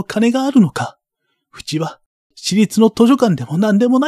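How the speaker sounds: put-on high, rising boy's voice pressing harder; a desperate boy demanding the truth; upset pleading boy.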